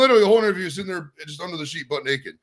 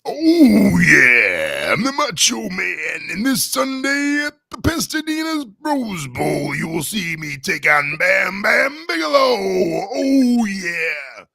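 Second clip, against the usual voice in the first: raspy, slow, shouting wrestler promo; Macho Man impression; 5 semitones higher, 5 dB louder, much slower than usual. Shown as a demonstration. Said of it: "Macho Man Randy Savage impression".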